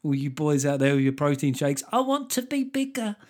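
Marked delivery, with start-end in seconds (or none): 1.9-3.2 high, put-on little voice; teasing high-pitched caricature; mock wishful boy. Mocking boys wanting protein shakes.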